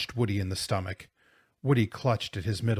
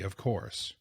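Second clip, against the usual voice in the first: ordinary delivery, nothing marked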